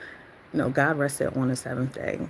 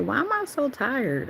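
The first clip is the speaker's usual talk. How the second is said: high-pitched, drawn-out puzzled question; mock bewilderment; 10 semitones higher, slower than usual. Wondering at the sleepiness that hits at work, high mock bewilderment. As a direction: wonder at yourself in a high, slow voice. Retold baffled question to herself.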